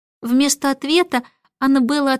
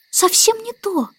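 high little voice, dissatisfied; impatient baby; 11 semitones higher than usual. A narrator's reading. Baby corrects the starling, high and impatient. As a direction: reject it like an impatient small girl. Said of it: "нетерпеливо перебила Аннабелла".

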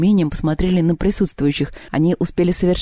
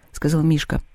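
ordinary delivery, nothing marked

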